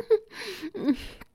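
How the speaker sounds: high, airy smug giggle; a little knowing laugh; teasing chuckle. Acted. Dark chuckle after teasing question.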